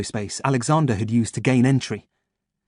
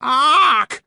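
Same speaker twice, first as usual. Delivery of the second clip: crow ark; 5 dB louder than usual. Acted. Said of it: "went a brute of a crow".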